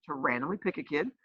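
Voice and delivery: normal talking voice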